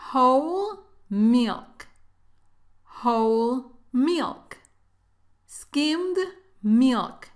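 ordinary delivery

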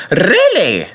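high, offended query; piqued, haughty disbelief; huffy. A narrator's reading. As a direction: say it in a huff. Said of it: "said James huffily".

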